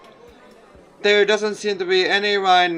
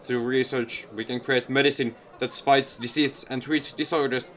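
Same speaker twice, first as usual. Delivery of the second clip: low, soft, measured power; placid lecturer; 4 semitones lower, 4 dB quieter than usual. Acted. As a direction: lecture calmly with quiet force. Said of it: "his voice is placid ... undercurrent of power".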